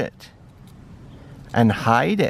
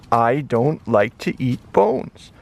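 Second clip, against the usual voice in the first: normal talking voice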